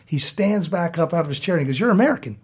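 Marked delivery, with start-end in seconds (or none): 1.8-2.4 mock-startled exclamation, higher pitched; retelling someone's sudden astonished realization; retold surprise. Brewery owner to the American visitor, surprised.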